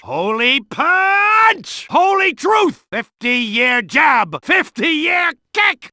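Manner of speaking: slow, stretched shout on Holy punch; drawn-out battle shout. Acted. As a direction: stretch "Holy punch" out as a big finishing yell. Attack name said twice.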